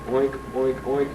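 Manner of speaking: lightly voiced pig grunts; mock pig oinks. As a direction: oink like a pig hiding in the mud.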